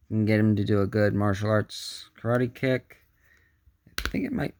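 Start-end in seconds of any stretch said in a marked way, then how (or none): none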